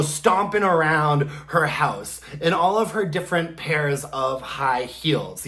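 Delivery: ordinary talk, nothing marked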